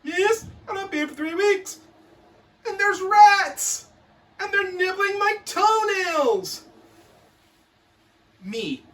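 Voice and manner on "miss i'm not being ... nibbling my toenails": put-on whiny kid. Prisoner pupil begs miss about rats, high wail. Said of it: "kid in school prison".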